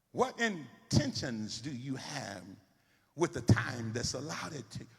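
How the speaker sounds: slow, pointed preaching question. Acted. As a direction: drive each question home slowly.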